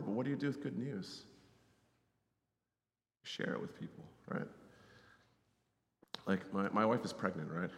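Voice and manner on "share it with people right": breathy whisper, no voice; hushed urging whisper. Acted. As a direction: whisper it like a secret to pass on. Pastor urging listeners to share.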